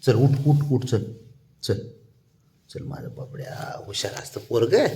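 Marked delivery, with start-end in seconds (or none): 2.7-4.4 soft coaxing whisper. Real, not acted. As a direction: whisper to the pet to coax it along. Coaxing the pet softly.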